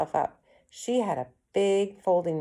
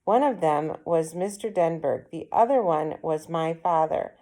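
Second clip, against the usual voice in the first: usual speaking voice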